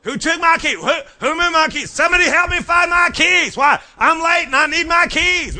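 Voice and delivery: high frantic shouting; yelling across the room. Retold or imitated. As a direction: holler for help finding keys. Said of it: "You screaming at everybody in the house".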